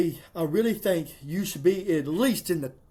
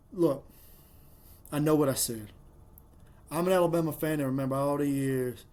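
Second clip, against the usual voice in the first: ordinary delivery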